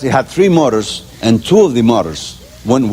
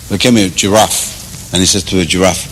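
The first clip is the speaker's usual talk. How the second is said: ordinary talk, nothing marked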